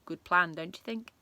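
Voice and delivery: ordinary delivery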